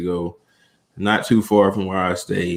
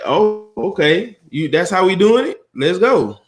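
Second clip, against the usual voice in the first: eager acted quote; 8 semitones higher than usual. Guest voices an imagined unhinged person joining in, high eager mimicry. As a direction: imitate someone suddenly all in.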